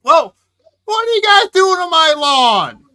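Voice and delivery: high, full-volume codger yell; angry old man. Acted. Old Wilbur chasing visitors off his lawn, furious hammed-up shouting. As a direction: bellow at trespassers like a cranky old man.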